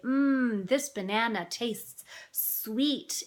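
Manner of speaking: put-on slow, savouring talk to a toddler; parent savouring food. Parent sharing a banana with a child, slow savouring. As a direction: draw it out to teach a little one. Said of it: "and they say".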